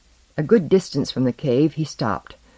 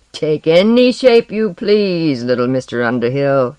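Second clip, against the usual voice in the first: taunting; 6 dB louder than usual. Acted. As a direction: sneer down at the little wizard. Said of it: "he taunted".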